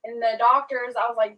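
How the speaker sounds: normal talking voice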